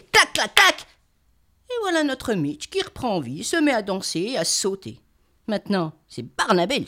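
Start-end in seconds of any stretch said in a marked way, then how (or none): 0.1-0.9 loud, gleeful clac clac; excited clapping. A narrator's reading. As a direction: clap it out with glee. Triumphant clapping.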